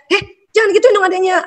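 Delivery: scolding parent. Retold or imitated. A parent scolds a child for teasing the sibling, sharp and high. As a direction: snap at the child like an irritated parent.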